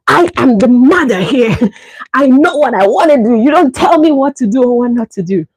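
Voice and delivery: a mother asserting authority to her son; indignant mother. Retold or imitated. Son lecturing her, mom pulls rank indignantly. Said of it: "I started feeling like a big girl".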